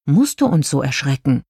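ordinary delivery, nothing marked

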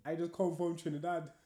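put-on answering the challenge in a Trinidadian accent. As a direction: show how a Trini accent should sound. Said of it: "what's your best Trinidadian accent".

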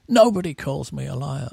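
normal talking voice

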